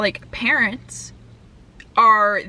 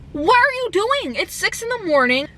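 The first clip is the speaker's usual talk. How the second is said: high-pitched retold disbelief; incredulous protest; 9 semitones higher than usual. Driver challenging the dog owner at dawn, incredulous. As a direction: exclaim it in exasperated disbelief, acting it out. Acting out her quarrel with the woman.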